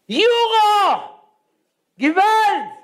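high, loud stunned exclamation; astonished cry. Retold or imitated. Rabbi reacts to a fat envelope of euros with astonished shouts. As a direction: gasp it out, amazed at the cash.

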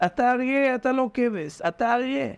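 drip the lie in gently and sweetly; coaxing flattery. Retold or imitated. Tempter sweet-talking the frightened sheep, high coaxing voice. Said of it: "מטפטף לו לכבש".

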